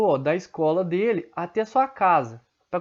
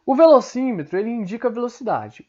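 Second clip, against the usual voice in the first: ordinary talk, nothing marked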